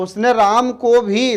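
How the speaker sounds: slowed, rising on को into भी; emphatic भी with a rising tune; stress on भी. Shown as a demonstration. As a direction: lift into भी to stress Ram too. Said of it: "विशेष जोर देकर बोल रहे हैं".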